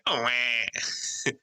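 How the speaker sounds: mock infant wail; imitated baby cry. Hearing crying after leaving the house, mock baby cry. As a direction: wail "wah" like a baby, for laughs. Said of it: "all I hear is baby crying".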